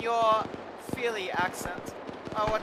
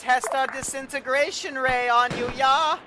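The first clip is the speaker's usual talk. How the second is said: mock German accent; 3 semitones higher, 4 dB louder than usual. German officer taunting his captive, in a mock German accent. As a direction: play a smug German officer gloating in a put-on accent. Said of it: "you really gotta work on your German accent".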